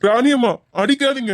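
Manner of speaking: high, tearful begging; crying plea. Acted. Uncle begs the mother not to hit the girl, crying. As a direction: plead for her to stop the beating.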